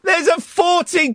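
ordinary delivery

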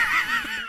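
sudden squeaky laugh; high burst of laughter. Real, not acted. Laughs at own joke.

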